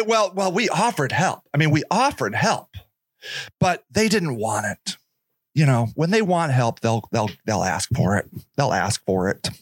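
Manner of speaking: low, self-satisfied official voice; mock official. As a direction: defend yourself like a smug spokesman. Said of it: "then they say".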